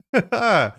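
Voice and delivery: a short, loud, high laugh at himself; burst of laughter. Real, not acted. Laughing at getting old.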